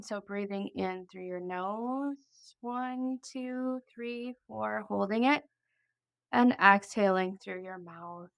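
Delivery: soft, unhurried breathing-exercise voice; guided meditation. Acted.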